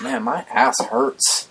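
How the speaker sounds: high, slow, drawn-out mock whine; exaggerated slow, high moan; retold complaint. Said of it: "I was like".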